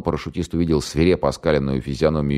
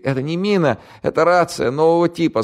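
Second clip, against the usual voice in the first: put-on much higher, louder panicky young man; scared radio operator; 13 semitones higher, 3 dB louder than usual. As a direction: blurt it out in terror. Голос радиста. (испуганный пленный радист, высоко и громко)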